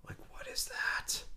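hushed whisper of confusion; breathy unvoiced question. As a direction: whisper it, half asleep and puzzled. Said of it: "I'm like".

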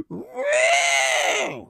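shrieked high woo, arms up; mock party woo. Comic imitating a heckler's piercing woo. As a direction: imitate the drunk lawyer's woo. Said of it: "the clip of her going".

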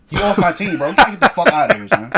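yelling, half laughing; loud fast ranting. Real, not acted. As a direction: shout it fast through laughs. Heated joking rant.

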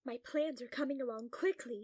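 usual speaking voice

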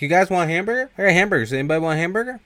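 O-Dog taunting after the robbery, mockingly cheerful; mocking offer. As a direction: wave the stolen burgers around. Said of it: "It's like".

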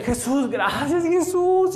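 high, slow cries climbing with emotion; overjoyed. Acted. Juan clinging to Jesus's feet, overjoyed. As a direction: cry out thanks, rising in pitch. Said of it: "Emocionado con, con esto".

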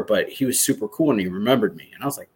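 ordinary delivery

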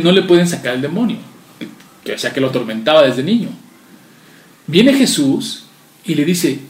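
normal talking voice

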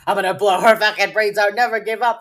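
loud, fast, high-pitched mock tirade; hammed-up furious outburst; mock violent rant. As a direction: blurt out an over-the-top threat, loud and fast. Mimics a violent radio outburst.

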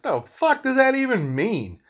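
high, slow disbelief; can't believe what he's reading; incredulous retort. Retold or imitated. Retelling his reaction to a friend's reply, incredulous. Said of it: "And I'm like".

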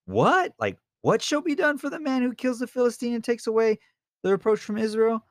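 high, stunned, incredulous; David can't believe what he's hearing; shocked disbelief. Retold or imitated. David hearing the reward, stunned and high-pitched. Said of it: "I feel like he's saying like this".

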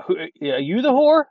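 slow, loud, high, incredulous question; can't believe what he's reading; baffled disbelief. Real, not acted. Host baffled by the letter, slow incredulous question.

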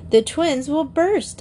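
high, insistent protest; mildly alarmed insistence; insisting. A narrator's reading. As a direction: insist with pitch lifted. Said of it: "Diana insisted".

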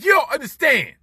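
high, loud cry of frustration; exasperated yell. Retold or imitated. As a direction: shout it like he just doesn't get it. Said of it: "I'm like".

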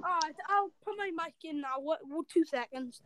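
faint, off-mic voice; barely audible, far from the mic; quiet voice. Real, not acted. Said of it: "You're really quiet for some reason".

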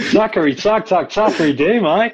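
loud, high mock-Australian chant; Australian accent. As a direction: play along in a broad Aussie accent. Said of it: "Straight in with the stereotype".

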